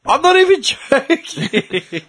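can't keep a straight face; laughing it out. Real, not acted. Repeats while laughing.

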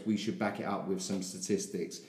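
ordinary delivery

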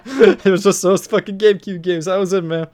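high, loud laughing talk; laughter spilling into the words. Real, not acted. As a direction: talk through real laughter. Still laughing while talking.

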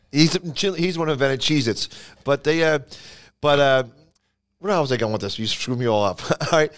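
ordinary talk, nothing marked

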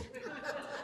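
a short real laugh on the out-breath; breathy laugh. Real, not acted. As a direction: laugh at the stolen sunglasses. Laughing about the stolen sunglasses.

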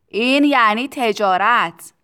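slow, loud pronouncement; loud declaration. A narrator's reading. Factory owner teaching Tistu what trade is, loud proclamation. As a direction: proclaim it slowly at full voice.

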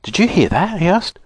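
high, urgent storybook question; startled. A narrator's reading. Joe coming in after the screech, startled.

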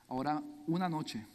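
ordinary delivery

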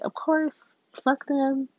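high, airy brush-off; sky-high don't-care voice; Missus Wu, breezy. Retold or imitated. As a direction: toss it off lightly, way up high. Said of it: "she's like".

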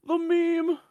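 shrieking way up high, excited fan; squealing fan. Pretend. Mocking a fan who sees a meme, squealing high.

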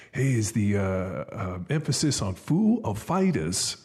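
a hammed-up Walken question; Christopher Walken impression. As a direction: stretch it out like Walken. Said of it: "he does this Walken imitation".

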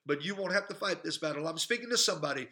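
ordinary talk, nothing marked

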